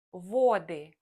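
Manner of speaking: Воды said va-DY, stress on the final y; vaDY, clear model to repeat; stress on the last syllable. Shown as a demonstration. Teacher drills learners: Воды stressed on the end. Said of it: "Repeat after me".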